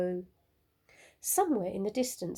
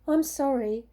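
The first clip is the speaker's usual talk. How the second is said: usual speaking voice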